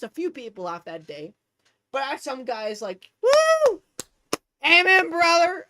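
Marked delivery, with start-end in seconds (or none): 3.2-5.7 loud, high whoop and shout; cheering supporters. Retold or imitated. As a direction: holler it like an approving congregation. Imitates men cheering her on.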